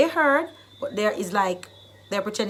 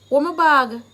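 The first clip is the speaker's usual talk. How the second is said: louder, higher demanding question; irritated-sounding demand, pushed up in pitch; moody demand; 4 semitones higher, 7 dB louder than usual. Shown as a demonstration. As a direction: ask it with attitude. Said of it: "Depends on your mood".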